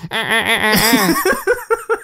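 giggly stuttering laughter; can't finish for laughing; high cackling laugh. Real, not acted. Breaks up laughing after the reading.

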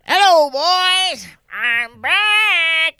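high, loud, slow hammed-up old pilot shout; old man's movie line. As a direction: belt it like the crazy old pilot. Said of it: "cómo es que dice el viejo ese".

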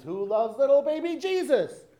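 high, loud mock shout of pageant kids; imitating children's shouted chant; shouted question. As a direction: call it across the aisle, pitched right up. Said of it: "they would shout".

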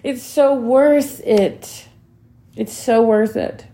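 holding back real tears as you say it; emotional. Real, not acted. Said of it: "I'm getting so emotional".

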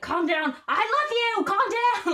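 high, strained mock shouting; urgent high-pitched imitated yell; retold screaming. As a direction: imitate someone yelling frantic affection to stop a fight. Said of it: "just screaming in his ear".

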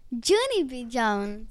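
"Junie" with a long oo, as in "June"; correcting pronunciation. Shown as a demonstration. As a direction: correct the name, saying "Junie" as JOO-nee. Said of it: "did I say it wrong".

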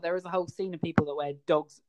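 normal talking voice

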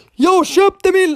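sudden high, drawn-out shout; loud, high holler, as a joke; joking yell. Pretend. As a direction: yell it playfully at full voice. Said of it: "Jag älskar att skrika".

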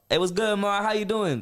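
high, lively hello to his mum; acted-out chirpy street greeting; upbeat greeting. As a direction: greet her brightly like one of the lads. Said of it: "same way I am the whole time".

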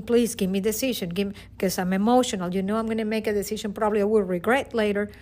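ordinary talk, nothing marked

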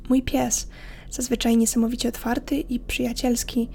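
ordinary talk, nothing marked

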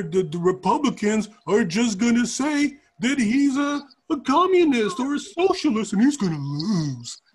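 dopey put-on voice, climbing to a whine; mocking dumb centrist. Host imitating centrist Democrats as he hears them, mocking dopey voice. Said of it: "this is how they sound in my mind".

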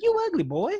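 high, loud mocking jab; mocking stepdad voice. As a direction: blurt it out like a rude stepdad. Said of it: "and he's like".

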